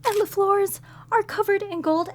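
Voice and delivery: high, breathless wonder at the treasure; thrilled explorer finding hidden riches; awestruck excitement. Acted. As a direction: gasp it all out in amazement. Amazed at treasure chamber.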